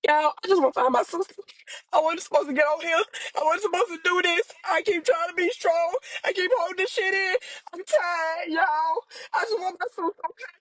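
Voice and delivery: real tearful plea, voice breaking into sobs; crying. Real, not acted. Sister pleading to find her missing sister, crying. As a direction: cry while trying to hold it in.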